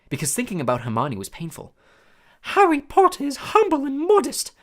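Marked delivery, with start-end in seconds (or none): 2.4-4.5 high, loud awed elf voice; worshipping his hero; reverent house elf. A narrator's reading. House elf praising Harry, high and reverent. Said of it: "said Dobby reverently".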